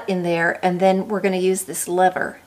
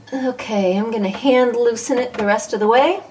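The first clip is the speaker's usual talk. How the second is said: ordinary talk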